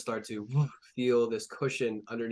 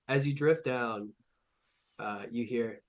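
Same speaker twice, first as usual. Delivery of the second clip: ordinary talk, nothing marked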